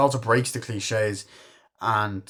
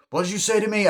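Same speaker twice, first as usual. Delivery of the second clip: high-pitched indignant quote; imitating someone offended; mock indignation; 12 semitones higher, 4 dB louder, much faster than usual. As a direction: act out an affronted challenge. Acting out offended character's challenge.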